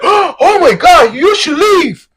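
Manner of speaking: high, slow mock gasp of shock. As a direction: gasp it out, scandalized. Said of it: "people are like".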